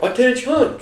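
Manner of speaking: high barked command; drill command. Retold or imitated. Instructor calling ROTC students to attention, barked. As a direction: call the class to attention.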